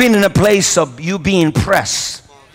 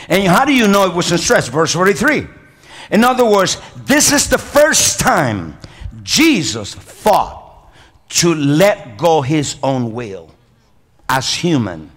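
normal talking voice

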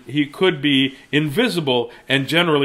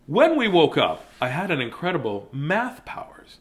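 ordinary delivery, nothing marked

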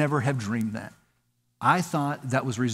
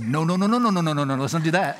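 rapid string of protesting no's, slightly raised; mock panicked refusal; 3 semitones higher, 4 dB louder, faster than usual. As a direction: blurt out a hammed-up refusal. Acting out refusing God's call.

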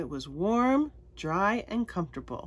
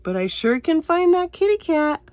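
triumphant; 3 semitones higher, 9 dB louder than usual. Real, not acted. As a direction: proudly announce the find. Triumphant finding.

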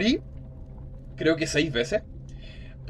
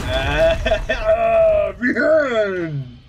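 loud triumphant yell with a laugh; victory shout; 4 semitones higher, 6 dB louder than usual. Real, not acted. Gamer beating the boss at last, victory shout. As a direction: roar the win.

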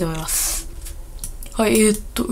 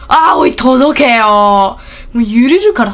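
straining; 5 dB louder, slower than usual. Real, not acted. Straining for a jump.

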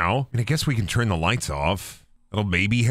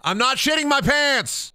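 indignant; 12 semitones higher than usual. Real, not acted. Indignant denial.

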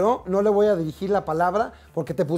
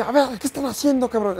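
higher, barking reprimand; yelling officer; 9 semitones higher than usual. Retold or imitated. Marine officer shouting at his men, barking. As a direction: bark at your own men. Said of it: "les empieza a gritar".

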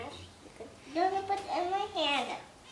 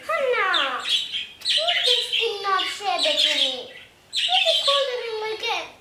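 loud upset complaint to her sister; complaining; 6 semitones higher, 8 dB louder than usual. Real, not acted. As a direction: protest loudly that she won't share. Child complaining at sister Hannah.

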